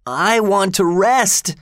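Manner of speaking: high, drawn-out whiny yell; boy's yell. A narrator's reading. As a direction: cry out slowly in a high boy's voice. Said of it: "yelled the boy".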